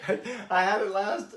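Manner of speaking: high-pitched, giggly apology; laughing apology. Real, not acted. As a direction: apologise while cracking up. Laughing apology about the whiskey.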